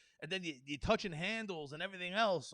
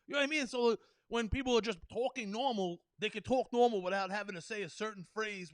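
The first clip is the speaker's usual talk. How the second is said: ordinary talk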